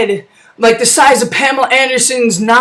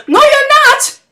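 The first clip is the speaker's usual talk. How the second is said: squeaky high mocking no; high taunt; slower than usual. [name] mocking Chelios, high squeaky retort. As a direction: taunt him in a much higher voice.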